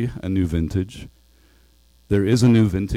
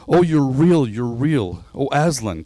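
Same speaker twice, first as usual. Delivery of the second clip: higher, louder burst of relief and joy; overjoyed cry; 5 semitones higher, 5 dB louder than usual. A narrator's reading. Lucy finds Aslan is real, crying out joyfully. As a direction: cry it out in amazed delight. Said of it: "cried Lucy".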